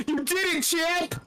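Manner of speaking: high, bright mock cheer for a champ. Onlookers cheer the narrator in the bedroom, mock cheering. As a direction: egg him on like a sports dad.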